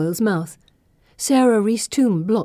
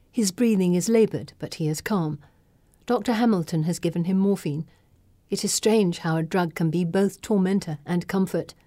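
ordinary delivery

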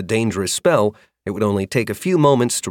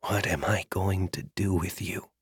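barely above a breath; whispering; 9 dB quieter than usual. Acted. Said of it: "he whispered".

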